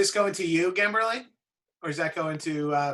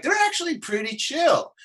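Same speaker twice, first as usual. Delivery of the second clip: retold pleasant surprise; 11 semitones higher than usual. Retold pleasant surprise about strangers.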